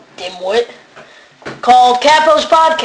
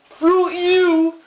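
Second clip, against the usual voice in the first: censored gangster line; 7 semitones higher than usual. Retold or imitated. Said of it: "they use replacements words like".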